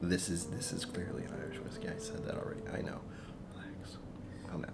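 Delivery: scolding himself in a hushed whisper; a hushed whisper to himself, like a private reprimand; whispered aside. Real, not acted. Muttering to himself mid-tasting.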